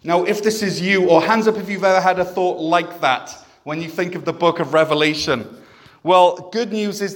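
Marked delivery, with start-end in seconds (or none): none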